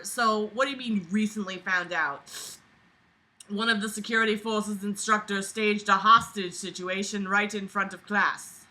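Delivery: ordinary talk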